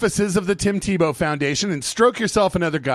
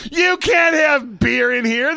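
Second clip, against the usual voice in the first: higher, drawn-out mock reprimand; scolding nurse; 6 semitones higher, much slower than usual. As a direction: imitate an outraged nurse telling someone off.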